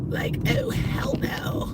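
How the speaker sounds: high breathy whisper of disbelief; whispered hell no; whispered outrage. Real, not acted. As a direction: gasp it out in a whisper.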